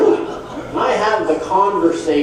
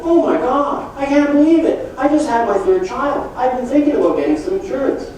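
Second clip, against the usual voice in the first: surprised Joe; 7 semitones higher than usual. Retold or imitated. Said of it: "Joe will go".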